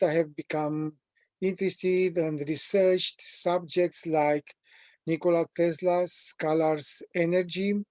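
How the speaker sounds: ordinary talk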